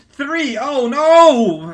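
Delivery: high, loud yelp of alarm; sudden mock shock at the numbers. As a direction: cry out in hammed-up panic. Mock alarm reading a comic.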